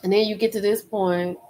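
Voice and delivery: ordinary talk, nothing marked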